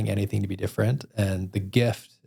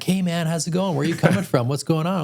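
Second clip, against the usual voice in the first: higher, eager chatterbox voice; chatty Uber driver; 8 semitones higher than usual. Retold or imitated. Mimicking the talkative Uber driver to a podcast host, eager and high. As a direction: imitate the overly friendly driver peppering questions. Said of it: "the chattiest dude ever".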